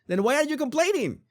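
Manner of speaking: high-pitched exasperated retort. Real, not acted. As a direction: throw it back in high-pitched disbelief.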